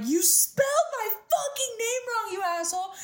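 sobbing, high-pitched accusation; retold crying yell. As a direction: replay the tearful outburst, voice cracking high. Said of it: "I'm bawling 'cause I'm like".

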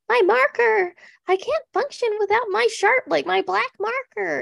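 high-pitched mock helpless fretting; hammed-up high, distressed impression; Michelle. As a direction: imitate a flustered mom lost without her things. Imitating Michelle missing her marker.

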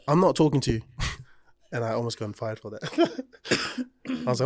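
usual speaking voice

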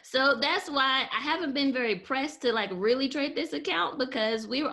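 laughing through the words. Real, not acted. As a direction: laugh as you start talking. Laughing after lozenge moment.

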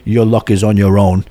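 a hint of a cold Russian casino man; Ivan Drago. Gambler mimics the rude Russian dealer, mild Russian accent. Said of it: "like Ivan Drago".

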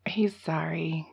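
breathy whisper, no voice; sarcastic stage whisper. Acted. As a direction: whisper it like a secret. Mock apology after abuse.

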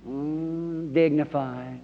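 low, soft mock-dignified hum; understated stuffy churchgoer; dignified skeptic. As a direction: play it reserved and proper, quietly. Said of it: "I'm quite reserved myself".

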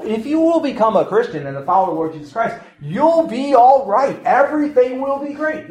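high, sing-out parody of false assurance; mock rosy promise. Preacher imitating people who promise converts an easy life, mockingly upbeat. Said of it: "this is the mistake that people have made".

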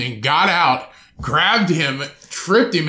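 usual speaking voice

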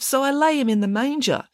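high-pitched, innocent reply; innocent explanation. A narrator's reading. Joseph explains himself innocently.

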